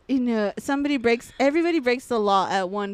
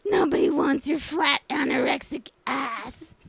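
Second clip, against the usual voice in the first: mocking bully voice; 2 semitones higher, slower than usual. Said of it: "but was like".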